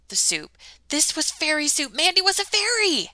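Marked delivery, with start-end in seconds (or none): 0.9-1.9 high, loud cry of discovery; excited realization. Acted. Ella realizing Mandy is a fairy, exclaiming high and loud. 1.9-3.1 high, loud cry of discovery; excited realization. Acted. Ella realizing Mandy is a fairy, exclaiming high and loud.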